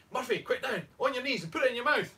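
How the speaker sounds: high, urgent Irish order; mock Irish accent. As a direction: press your mate in put-on Irish. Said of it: "say it with an Irish accent".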